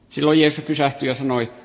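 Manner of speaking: ordinary delivery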